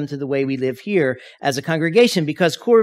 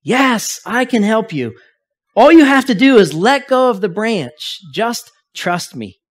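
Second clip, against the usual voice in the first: a hint of a booming heavenly voice, louder; booming voice from above; 6 semitones higher, 6 dB louder than usual. God answers dangling skier from above, mild booming voice. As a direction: boom it down like God, easing off to a gentle trust me. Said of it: "The voice boomed back".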